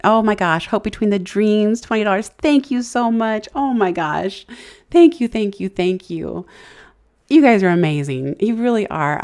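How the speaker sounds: high, loud, gushing thank-yous; overjoyed surprise at a gift; excited thanks. Real, not acted. Streamer thanks Hope Between the Dreams for twenty dollars, overjoyed.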